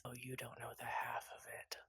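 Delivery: low, breathy whisper; whispered reply. Acted. Tall woman whispering back to the player, weary.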